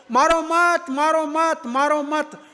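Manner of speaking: ऊँची आवाज़ में खिंची हुई, दोहराती गुहार की नकल. Retold or imitated. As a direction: plead in a high, drawn-out chant, as if retelling it. भ्रूण इंद्र से गुहार लगाते हैं. (गुहार लगाने की नकल, ऊँचे सुर में)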